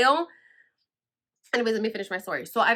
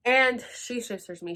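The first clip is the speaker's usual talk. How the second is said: normal talking voice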